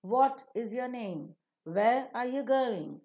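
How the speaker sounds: slow questions, "name" and "going" falling. Shown as a demonstration. Teacher gives WH question examples, falling tone. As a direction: bring "name" and "going" down at the end. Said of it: "normally will receive the falling tone".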